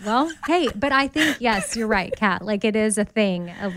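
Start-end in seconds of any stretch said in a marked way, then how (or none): none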